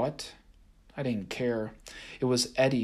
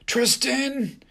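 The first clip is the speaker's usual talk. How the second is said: high, loud desperate cry; shouted name; 14 semitones higher, 5 dB louder than usual. A narrator's reading. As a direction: yell after the fleeing boy. Haint shouts after the boy.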